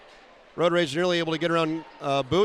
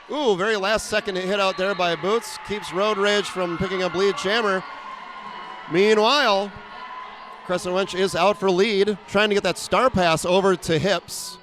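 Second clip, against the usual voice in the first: louder, rising call of a big hit; excited play-by-play; 3 semitones higher, 4 dB louder than usual. Real, not acted. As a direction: call the hit like the crowd just erupted.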